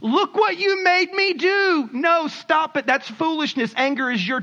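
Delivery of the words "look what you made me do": high, drawn-out accusing whine; blaming others. Acted. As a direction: wail it slowly, shifting the blame. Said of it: "you blame other people".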